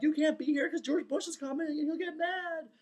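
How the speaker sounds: very high, hammed-up panic; freaking out. Dreamer shooing a friend out before the president arrives, panicking. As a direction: plead in a squeaky-high frantic voice. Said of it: "freaking out".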